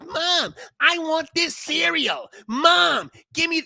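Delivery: put-on high, whiny little kid begging. Spoiled kid whining to his mom for cereal. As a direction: beg like a spoiled child in a store. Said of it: "He goes".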